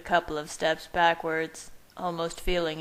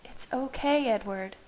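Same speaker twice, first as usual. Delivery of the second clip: soothing; 6 semitones higher than usual. A narrator's reading. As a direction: soothe him gently. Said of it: "Bella said soothingly".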